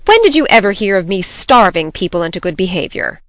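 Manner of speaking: loud, indignant retort. A narrator's reading. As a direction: demand it, offended and forceful. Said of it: "demanded Marilla indignantly".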